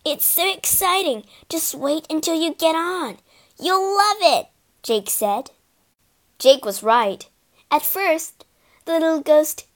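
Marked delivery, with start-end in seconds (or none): 0.1-4.5 put-on high-pitched, excited child character; excited, higher-pitched urging; eager coaxing. A narrator's reading. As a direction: talk it up with excitement. Eager Jake coaxes the ghost.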